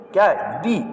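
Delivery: ordinary talk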